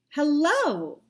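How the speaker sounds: happy hello. Shown as a demonstration. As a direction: greet them with a big cheerful burst. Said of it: "I would agree that was strangely happy".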